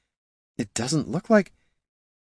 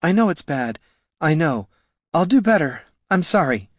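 put-on higher-pitched drunken apology; sorry pleading; 8 semitones higher than usual. A narrator's reading. Sick ranger promising to do better, pitifully apologetic. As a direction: apologize pitifully between heaves.

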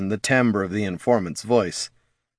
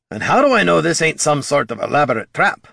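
gruff dwarf voice; 6 semitones higher, 4 dB louder than usual. Acted. Dwarf Gorm suspicious of trap.

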